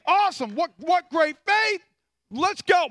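high, loud, hyped-up quote; acted enthusiasm. Jesus praising great faith, hammed-up enthusiasm. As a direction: cheer it like a keen leader.

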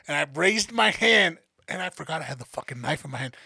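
ordinary talk, nothing marked

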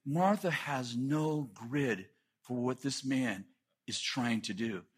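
usual speaking voice

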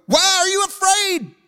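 high, loud, incredulous scolding; just woken and yelling at them in disbelief; slow, high-pitched outburst of exasperation; exasperated Jesus. Acted. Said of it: "apologize for my tone of voice".

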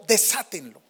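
"Desátenlo" as a firm command; voice of authority. Acted. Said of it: "una palabra de autoridad". (orden con autoridad)

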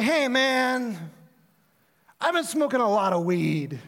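high, loud, dopey "hey man"; stoned caller. Retold or imitated. Pastor mimicking the caller who smoked weed, loud and dopey. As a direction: stretch it out like a mellow stoner.

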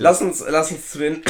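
hoarse from a cough, slow and strained. Real, not acted. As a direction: push through a scratchy coughing throat. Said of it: "ah, der Husten".

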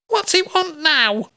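high, slow nosy mum; pry like a curious mother. Acted. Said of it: "asked my ever-inquisitive mother".

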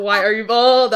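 loud, exasperated mock demand; mock outburst. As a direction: blurt it out loudly in disbelief. Mock outburst at actor's shaved head.

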